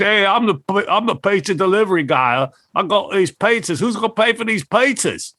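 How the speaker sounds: high, cheeky Aussie bloke voice; pizza delivery guy. Retold or imitated. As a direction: play the brash delivery guy joking. [name] pranks as pizza guy.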